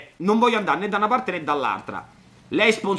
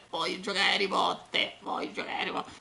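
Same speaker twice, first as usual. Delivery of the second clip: whispered; 10 dB quieter than usual. Shown as a demonstration. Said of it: "repeats the line, fading away".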